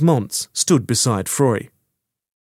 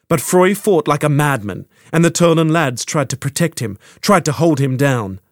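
ordinary delivery, nothing marked

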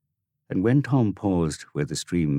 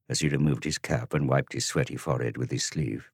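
usual speaking voice

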